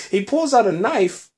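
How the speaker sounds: can't believe it, laughing; laughing disbelief. Real, not acted. Recapping the knife to a gunfight, laughing.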